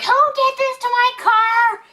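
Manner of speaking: high, loud angry yell out the window; furious yell from the car window; retold yell. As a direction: shout it at whoever smashed the car. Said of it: "I'm yelling out the door".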